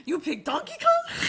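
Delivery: high, loud questioning catchphrase; catchphrase quote. Retold or imitated. Retelling how they tease with every character, high mock challenge. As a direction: challenge them in a laughing, high voice.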